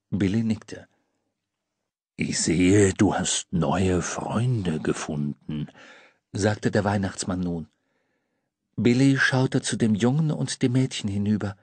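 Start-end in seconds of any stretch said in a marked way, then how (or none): 2.2-5.7 low, slow, kindly Santa; deep Santa voice. A narrator's reading. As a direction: say it with fond approval. Santa to Billy.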